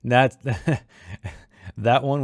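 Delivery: can't hold back a laugh at his own writing; laughing at his own line. Real, not acted. Author reading his story to listeners, laughing. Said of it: "really hard not to say in a Southern accent".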